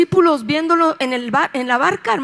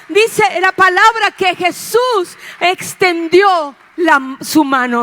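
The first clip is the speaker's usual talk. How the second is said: higher, louder fervent preaching; preaching at full force; 6 semitones higher, 4 dB louder than usual. Real, not acted. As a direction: lift the congregation to praise. (predicando con fervor, más alto y fuerte)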